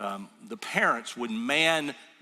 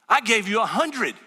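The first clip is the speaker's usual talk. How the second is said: louder, higher retold yell at the cashier; angry yell; 6 semitones higher, 6 dB louder than usual. Angry customer yelling at the cash drawer preacher, mock yell. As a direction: throw the accusation back like the angry customer. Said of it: "all of a sudden, he's mad".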